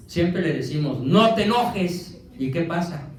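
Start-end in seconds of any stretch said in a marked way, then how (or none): 1.0-2.2 higher, louder, slow "no te enojes"; mock scolding. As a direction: snap it out like a nagging parent, slowly. Mimics telling angry person calm down.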